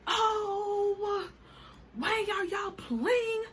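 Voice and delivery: high, slow put-on protest voice; mocking the driver who thinks they are joking; imitating the driver. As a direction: whine it like the upset driver.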